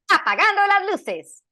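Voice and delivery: put-on high, loud Spanish-accented flourish; Spanish accent, playful and loud; Sombra voice line. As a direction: sign off like the hacker Sombra. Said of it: "Sombra would say".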